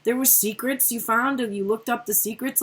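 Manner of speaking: ordinary talk, nothing marked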